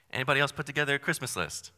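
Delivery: ordinary delivery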